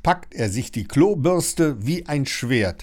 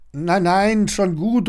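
high, louder reassurance; relieved call; 8 semitones higher, 5 dB louder, slower than usual. A narrator's reading. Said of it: "ruft Lilly erleichtert".

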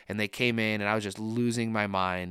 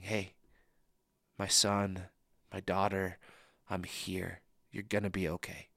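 softer, unhurried reassurance with gentle pauses; tender comfort; 5 dB quieter than usual. Retold or imitated. Pastor voices Jesus reassuring the suffering listener, soft and tender. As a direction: speak as a loving father to his child.

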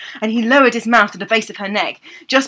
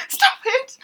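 wilting maiden. Acted. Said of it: "I don't do real, like, wilting maiden".

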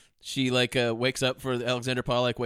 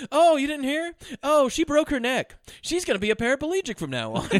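high, loud, mock-casual child; blithe news-breaking; 11 semitones higher, 4 dB louder than usual. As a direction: break awful news as chirpy gossip. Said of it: "He says it just like that".

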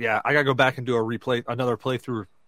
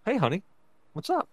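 soft, rising fatherly question; father gently asking his sleepwalking daughter what's up; gentle parent question; 7 semitones higher, 3 dB quieter than usual. Retold or imitated. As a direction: ask the child gently, pitch climbing at the end. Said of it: "I look at her, and I go".

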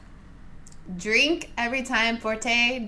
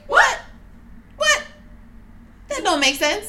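mock confusion; 10 semitones higher than usual. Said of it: "that was just like".

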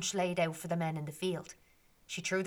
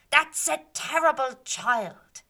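slow, louder and higher outraged cry; fairies shouting; 6 semitones higher, 7 dB louder, slower than usual. Acted. Fairies shouting about the wasteful child, slow and loud. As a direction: call her out slowly and loud. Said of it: "they began to shout".

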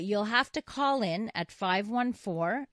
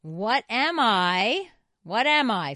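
teasing quiz question; 3 semitones higher, 7 dB louder, slower than usual. Acted.

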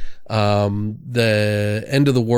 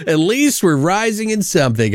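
ordinary talk

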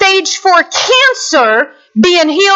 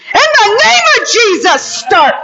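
commanding shout; 7 semitones higher than usual. Retold or imitated. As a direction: command the engine like a preacher. Commanding the van to start.

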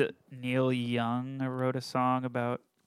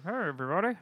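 high-pitched puppet greeting; 6 semitones higher, much faster than usual. Pretend. Puppet greeting coworkers, high put-on voice. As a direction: greet the room as a little hand puppet. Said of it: "do I have to be a ventriloquist".

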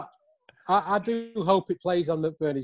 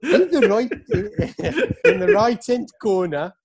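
high, laughing mock Norwegian accent; 11 semitones higher than usual. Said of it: "Like your Norwegian accent again".

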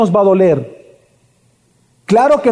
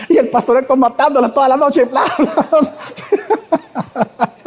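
laughing; 5 semitones higher, slower than usual. Real, not acted. Laughing retelling of funny night.

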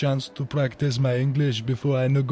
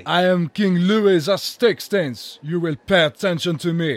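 indignant royal command; 4 semitones higher, 4 dB louder than usual. Acted. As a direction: demand it like an offended king. King demands attention, indignant.

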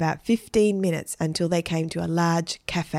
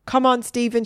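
higher, lightly called invitation; calling out; 6 semitones higher, 4 dB louder than usual. A narrator's reading. Girl to Stephen before going out for croissants, calling. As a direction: call to him to hurry along. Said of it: "she called".

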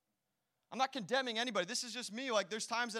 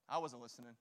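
flat admission; 9 semitones lower, 6 dB quieter, faster than usual. Retold or imitated. Kid's blunt admission.

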